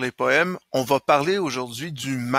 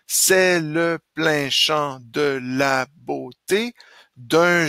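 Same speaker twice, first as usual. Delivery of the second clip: slow, measured verse reading; eight-syllable metre; slower than usual. Shown as a demonstration. Teacher rereading the first stanza of Le Sport, measured eight-beat recitation. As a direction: recite in even beats, syllable by syllable. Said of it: "en essayant de faire le, le mètre de huit".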